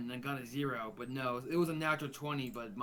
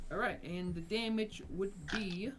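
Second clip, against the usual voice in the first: usual speaking voice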